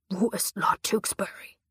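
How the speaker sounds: whispered threat from a knife-wielding man; whispered demand, knife at her throat; menacing whisper. A narrator's reading. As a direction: press the question in a dark whisper. Attacker demands again, whispered.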